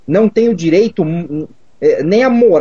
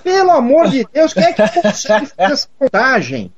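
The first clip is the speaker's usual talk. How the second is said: high-pitched exasperated disbelief; incredulous exclamation; 7 semitones higher than usual. Real, not acted. Guest scoffing to the host at absurdly precise gun figures, incredulous.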